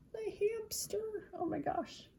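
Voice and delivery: high, soft squeal; tickled little high-pitched gush; delighted aside. Real, not acted. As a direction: coo it softly, way up high. Cooing aside at the picture.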